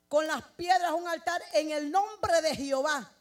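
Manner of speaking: the verse proclaimed loud as a preacher; full-force preaching. Acted. Preacher proclaiming Elijah's altar to the congregation, loud. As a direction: preach the verse out to the whole church.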